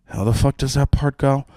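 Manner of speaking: a hint of pre-show panic, muttered fast; quick anxious aside to himself; lightly voiced stage fright; panicked inner voice. Retold panicked pre-stage thought.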